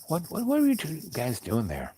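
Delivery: mildly acted stammering query; imitated snowplow driver. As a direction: play the puzzled rescuer asking. Driver questioning the bickering couple.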